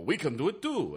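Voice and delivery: cheesy fake Russian accent; terrible Russian accent. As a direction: ham up a bad Russian accent. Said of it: "that was a terrible Russian accent".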